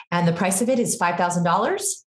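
DOLLARS lifts up like a question; asking the price. Shown as a demonstration. Seller giving the price on a sales call, question inflection on "dollars". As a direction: state the price, then rise unsurely on "dollars". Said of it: "So it sounds like this".

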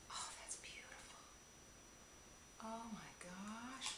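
awed whisper. Real, not acted. Admiring the lipstick shade, whispering. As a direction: hush it out admiringly.

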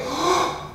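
quick high gasp before a breath hold; sharp gasp. Shown as a demonstration. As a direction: gasp in hard. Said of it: "I'm gonna do like".

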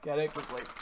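ordinary talk

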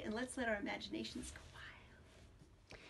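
slow hushed whisper. Acted. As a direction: breathe it out softly, slowly. Storyteller whispering opening.

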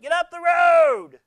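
high, loud, slow mock shout; hammed-up road-rage shout; imitated road shout. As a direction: yell it at the car in front. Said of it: "She went".

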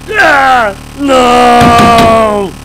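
very loud, high screams of despair; anguished screaming. Acted. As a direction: scream it out in total meltdown. Computer crash, screaming in rage.

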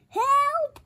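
high, loud storybook cry; crying for help. A narrator's reading. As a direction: call out for rescue, pitched high. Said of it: "cried the witch".

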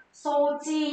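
"Sochi" with the first vowel held long. Shown as a demonstration. Teacher shows learners the reading, long vowel in "Sochi". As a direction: draw out the "So" in "Sochi", then "chi". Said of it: "Chữ trang thì sẽ có trường âm".

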